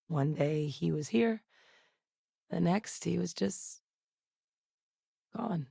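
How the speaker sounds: soft, halting, trailing into a hush; quietly reliving a loss; subdued recollection. Acted. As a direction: let the words fall away softly, with long pauses. Acted grief over someone killed.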